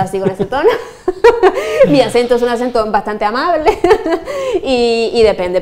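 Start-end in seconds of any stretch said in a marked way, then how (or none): 0.7-1.8 bright rising burst of laughter; high laugh. Real, not acted. Laughs after "not angry like that. 3.8-4.6 laugh. Real, not acted. Laughing continues.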